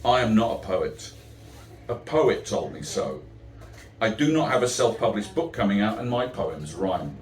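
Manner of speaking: rhythmic, sing-song verse delivery; comic verse read to a crowd; poem recitation. Acted. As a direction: recite it like a stand-up poet. Performs the poem I Am Not.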